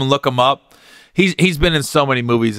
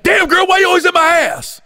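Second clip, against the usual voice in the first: mock yell. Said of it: "he just yells at her".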